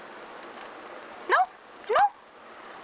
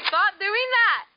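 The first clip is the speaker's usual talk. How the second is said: annoyed yell; 6 semitones higher, 6 dB louder, slower than usual. Real, not acted. Yelling at someone filming her.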